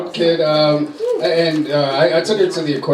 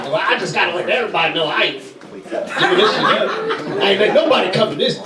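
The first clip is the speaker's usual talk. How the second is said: sassy audience member; 5 semitones higher than usual. Retold or imitated. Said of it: "she's like".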